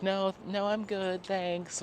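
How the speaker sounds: high-pitched mock refusal; polite brush-off. Housemate bowing out from his room, high mock-polite refusal. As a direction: imitate someone begging off from upstairs.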